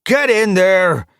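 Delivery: loud, high, drawn-out command; forceful high shout; shouted order. Acted. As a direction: yell it as a harsh order. Cat orders prisoner inside.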